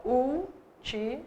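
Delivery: últimos split slowly into syllables, úl-ti-mos. Shown as a demonstration. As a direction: stretch últimos out beat by beat. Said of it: "Se você for separar". (últimos dito devagar, sílaba por sílaba)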